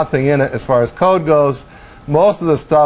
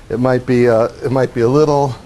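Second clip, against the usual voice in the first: ordinary talk, nothing marked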